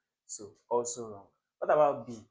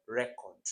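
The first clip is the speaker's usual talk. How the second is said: "record" stressed on the first syllable, REC-ord. Shown as a demonstration. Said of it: "Record has the stress where it should be".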